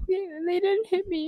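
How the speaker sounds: crying. Retold or imitated. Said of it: "I'm still profusely crying".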